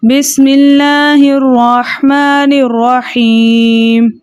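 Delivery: slow melodic tajweed chant with long held vowels; Quranic recitation. Shown as a demonstration. Teacher opens the lesson for students with slow chanted recitation. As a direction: recite it drawn out and tuneful, like tilawat. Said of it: "तिलावत से करते हैं".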